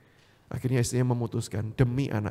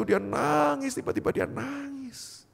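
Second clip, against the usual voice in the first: tearful narration; 13 semitones higher, slower than usual. Retold or imitated. Acts out the weeping he narrates.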